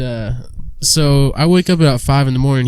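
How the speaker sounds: ordinary talk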